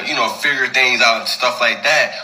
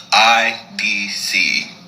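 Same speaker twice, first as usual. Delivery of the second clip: three letters, very slowly spaced out; deliberate spelling-out; 2 semitones lower, much slower than usual. Retold or imitated. Reads out his curt text reply.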